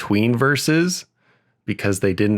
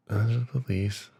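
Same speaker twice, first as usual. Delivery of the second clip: much softer recall; muttered to self; 8 dB quieter than usual. Real, not acted. Reciter jogging memory of the country list, muttered. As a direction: repeat it under your breath to remember.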